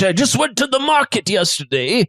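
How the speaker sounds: theatrical host talking up ingredients; proud chef character. Acted. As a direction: offer it proudly in a put-on chef voice.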